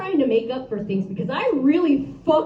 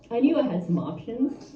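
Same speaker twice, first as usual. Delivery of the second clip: normal talking voice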